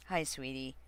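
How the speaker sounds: ordinary delivery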